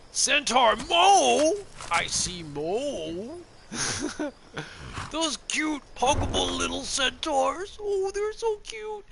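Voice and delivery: put-on very high, slow baby-talk gushing; cutesy high voice. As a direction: coo over it like a cuddly pet. Gushing over cute centaurs.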